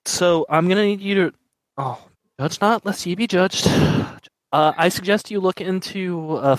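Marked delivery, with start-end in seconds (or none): 0.1-3.7 pitched-up solemn priest intoning scripture; high, solemn priest voice; priest character voice. Acted. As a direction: lift the voice and intone it like a holy man.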